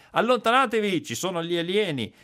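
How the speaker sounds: high-pitched, hammed-up alien scare; mock alarmed warning. Officials scaring off onlookers with a cover story, mock alarm. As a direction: warn off the curious in a put-on high voice. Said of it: "la storia di copertura".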